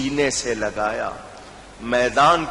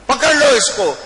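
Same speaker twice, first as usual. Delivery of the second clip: high, loud command to seize him; گرفتاری کا حکم; shouted order; 10 semitones higher, 5 dB louder than usual. Acted. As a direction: bark the order at the troops.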